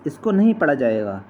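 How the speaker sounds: ordinary talk, nothing marked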